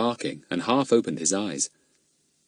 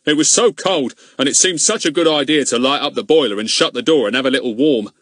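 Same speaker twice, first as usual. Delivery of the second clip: gruff policeman; 5 semitones higher, 6 dB louder than usual. Acted. Policeman voiced in story.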